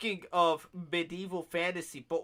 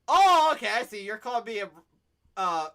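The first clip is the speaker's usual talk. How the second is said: mock surprised; 5 semitones higher, 6 dB louder than usual. Retelling his reply in Chiba.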